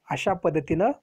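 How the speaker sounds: ordinary delivery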